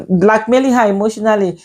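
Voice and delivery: ordinary delivery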